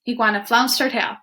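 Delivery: ordinary delivery, nothing marked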